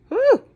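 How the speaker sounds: loud high-pitched whew; high amazed whew. Pretend. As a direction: squeal it out in mock awe. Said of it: "reacting to scary scene".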